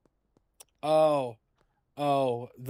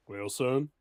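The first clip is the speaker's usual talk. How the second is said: lightly Southern drawl on well, son; Southern grandpa. Shown as a demonstration. Grandpa lecturing son in Southern accent, mild. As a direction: settle into a Southern old man's well, son. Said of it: "I could go southern".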